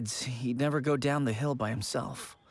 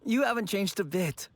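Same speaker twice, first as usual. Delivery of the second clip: ordinary talk, nothing marked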